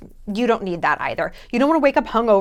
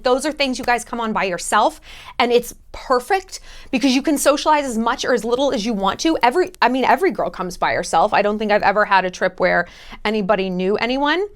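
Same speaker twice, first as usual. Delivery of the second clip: ordinary delivery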